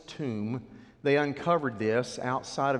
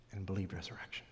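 much softer and lower; hushed awe; 5 semitones lower, 11 dB quieter, faster than usual. Acted. Preacher telling how John believed, hushed. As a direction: drop to a reverent hush.

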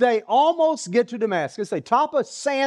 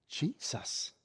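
awed whisper; 3 semitones lower, 15 dB quieter than usual. Acted. Saul gasps the name.

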